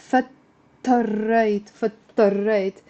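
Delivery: Fattarrayt said very slowly with a doubled t; slow repeat drill. Shown as a demonstration. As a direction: slowly model Fattarrayt again for the class. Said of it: "Did you get it?".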